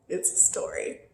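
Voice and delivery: normal talking voice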